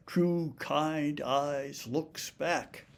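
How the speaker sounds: "True kind eyes looks back" with every word stressed, very slow; five heavy stresses. Shown as a demonstration. Lecturer shows five stressed words in a row, each one hit hard. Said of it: "five strongly stressed monosyllables in a row".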